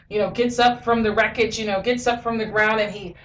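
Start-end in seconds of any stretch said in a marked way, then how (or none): none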